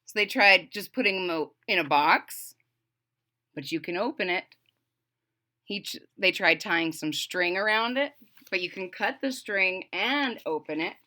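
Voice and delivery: ordinary delivery, nothing marked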